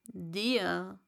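a touch of scorn; mildly scornful caterpillar; contemptuous Raupe. Acted. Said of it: "sagte die Raupe verächtlich". (leicht verächtlich)

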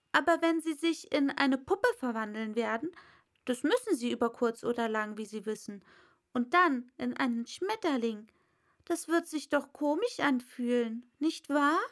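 put-on an eager young girl; Alice. Alice voiced. (schnelles, hohes Mädchen)

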